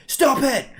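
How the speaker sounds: high, loud frightened cry; mock panicked yell. Imitating girlfriend in night terror, a high loud yell. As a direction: shriek it like she's under attack. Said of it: "She's like".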